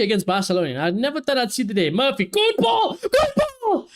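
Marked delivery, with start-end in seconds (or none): 1.9-3.9 high, thrilled cry of praise; excited cheer. Real, not acted. Fan cheering a great pass from his winger, thrilled shout. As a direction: yell it as the ball lands perfectly.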